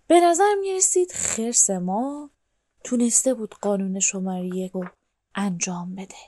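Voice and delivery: ordinary delivery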